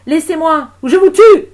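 high, very loud furious scream; enraged shriek at full volume; screamed threat. Acted. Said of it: "hurla Gilda".

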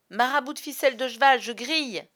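high, loud burst of alarm; startled yelp. A narrator's reading. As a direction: leap up yelling. Hook leaps off hot seat. (cri de surprise brûlé)

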